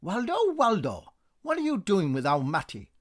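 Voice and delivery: high, loud scolding; scolding call. A narrator's reading. Neighbour women catching Waldo with Matty, loud scolding. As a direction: catch him red-handed and scold.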